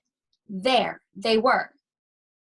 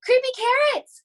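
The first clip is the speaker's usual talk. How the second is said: very high, loud panicked yell; terrified shout; 14 semitones higher, 4 dB louder than usual. Acted. Scared boy shouts for his dad at night. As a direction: shout it in pure fright. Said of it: "he shouted".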